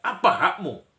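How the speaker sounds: high, loud scolding, easing toward the end; indignant yell in a staged argument; acted shouting quarrel. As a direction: play someone berating a litterer in public. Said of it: "langsung teriak".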